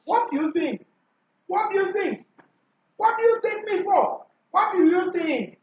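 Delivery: "What do you think" pitched high as a question; rising-tone demonstration. Shown as a demonstration. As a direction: model the question, lifting "you think" well up. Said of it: "Rising tone".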